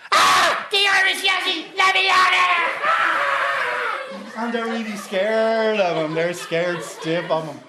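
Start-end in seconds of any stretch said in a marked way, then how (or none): 0.1-3.2 high panicked shriek; terrified shout. Retold or imitated. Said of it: "they're going". 3.2-4.4 high piercing scream of fright. Retold or imitated. Mock terror after the shout.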